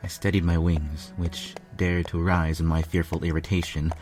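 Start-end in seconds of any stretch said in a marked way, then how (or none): none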